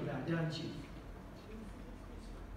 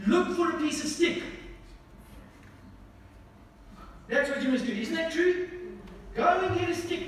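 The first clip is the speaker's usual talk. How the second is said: fiery preaching; 6 semitones higher than usual. Acted. Preaching at full force.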